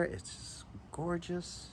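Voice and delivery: soft, slow, hushed praise; awed murmur. Real, not acted. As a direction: savour the word as you look. Admiring the Damascus pattern.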